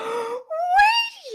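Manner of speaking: big high gasp of surprise. Acted. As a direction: gasp as it dawns on her. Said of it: "she's like".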